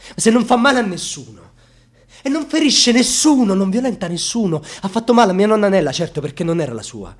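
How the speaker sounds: high, loud, building outcry; anguished outcry. Acted. Grandson crying out over nonna Nella's pain, loud anguished outcry. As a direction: hurl it out in grief and rage. Outburst about grandmother's suffering.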